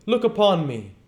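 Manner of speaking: high, loud hearty command; commanding ghost. Acted. Ghost tells Scrooge to look at him, high and loud. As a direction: bid him look, grandly.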